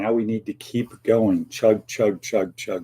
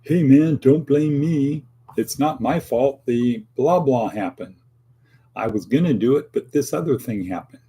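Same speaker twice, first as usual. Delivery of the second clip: defensive excuse; 3 semitones higher than usual. Shown as a demonstration. Host imitating someone dodging responsibility, defensive excuse-making. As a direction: push the blame away, hammed-up.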